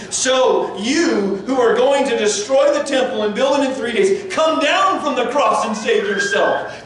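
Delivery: high, taunting sneer; mocking jeer. Passers-by taunting Jesus on the cross, high sneering jeer. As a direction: jeer at him from the crowd. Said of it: "Can you imagine the mocking".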